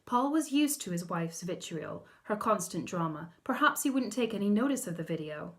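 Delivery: ordinary delivery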